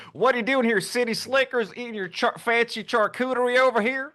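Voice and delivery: put-on a swamp local in a Southern Louisiana accent, calling out the picnickers. Locals mocking city slickers at a swamp picnic, Louisiana accent. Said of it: "I made him sound like the Southern ... Louisiana accent".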